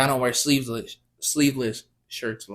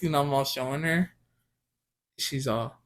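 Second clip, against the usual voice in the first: usual speaking voice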